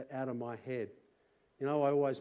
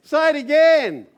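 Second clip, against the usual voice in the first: loud, much higher, rousing the congregation; fiery preaching; 8 semitones higher, 12 dB louder than usual. Acted. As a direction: preach it out to the whole room. Preaching to congregation at full force.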